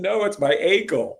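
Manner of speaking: high, loud mock protest; retold protest. Injured player to worried teammates, high retold protest. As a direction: cry out to correct them. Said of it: "And I said".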